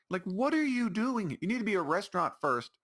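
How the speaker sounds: what are you even doing, exasperated; ranting at the market's policy, baffled; exasperated disbelief. Real, not acted. Ranting at the restaurant.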